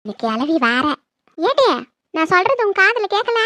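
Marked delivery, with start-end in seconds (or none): 1.4-3.4 much higher, yelling across the room; angry yell. Acted. Mother-in-law shouting at her daughter-in-law, angry yell.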